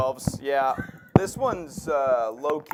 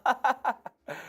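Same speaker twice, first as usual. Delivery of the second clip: laugh; 11 semitones higher than usual. Real, not acted.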